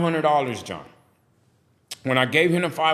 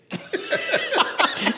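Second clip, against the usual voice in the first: sudden high laugh; high burst of laughter. Real, not acted. Bursts laughing mid-quote.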